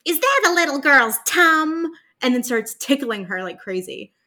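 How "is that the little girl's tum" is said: higher, louder creepy creature voice; high, loud creepy leer; creepy Toad Boy voice. Retold or imitated. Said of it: "he looks in and goes".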